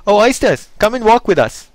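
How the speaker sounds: high, loud coaxing plea from the walrus; walrus beseeching. Acted. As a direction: lure the oysters in, calling sweetly. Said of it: "the walrus did beseech".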